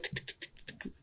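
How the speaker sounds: soft voiceless tick-tick-tick of falling tiles; whispered clicking like dominoes toppling; imitated domino clicks. Shown as a demonstration. Describing a game to his cohost, whispered clicks. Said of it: "they go tick".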